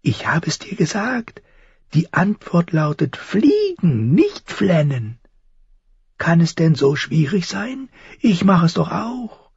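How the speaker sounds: high, thin scolding crow; crow croaking. A narrator's reading. Crow tells boy to fly not cry, croaking. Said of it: "krächzte die Krähe".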